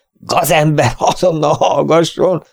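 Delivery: high, furious yell at the prisoner; full-throated shout. A narrator's reading. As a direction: bellow it at the top of your lungs. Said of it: "Kiáltotta teli torokból Hunton".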